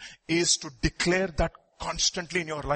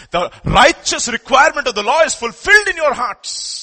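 higher and louder declaration; loud preaching; 8 semitones higher, 10 dB louder than usual. Real, not acted. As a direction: declare it with full force. Preacher's climax.

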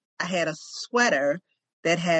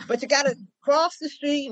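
imitating the guy; 9 semitones higher than usual. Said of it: "He was like".

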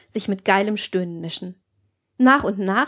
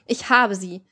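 ordinary delivery

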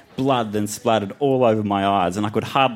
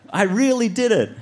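high, triumphant cry; yelled; 12 semitones higher than usual. Acted. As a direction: shout it high in childlike triumph. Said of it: "I yelled".

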